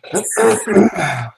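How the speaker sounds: hard rasping throat clear; harsh throat clearing. Real, not acted. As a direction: clear water from your throat. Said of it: "Water went down the wrong pipe".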